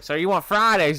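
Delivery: lightly voiced southern waitress; southern accent. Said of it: "she's like".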